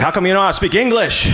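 quick, snappy mock retort; fast comeback. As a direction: fire it straight back, fast. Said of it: "I was like".

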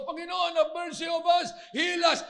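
high, loud imitated begging; acted cry for mercy, pitched high; pleading shout. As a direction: shout for healing like a desperate leper. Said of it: "Eh, ito, nagsisigaw".

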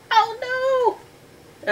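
very high, drawn-out wail; mock dread. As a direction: play up alarm for the listening children. Storyteller reacts to possible shark.

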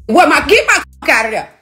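yelled high and loud; high mock yell. Retold to fans, yelling at her ex in the driveway. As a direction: bark it up high at him. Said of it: "I said".

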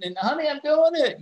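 high, loud mock snap at husband; berated mother. Hacker playing a berated wife to her husband, exasperated. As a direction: act exasperated, voice shooting way up. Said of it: "acting like the husband's berating her".

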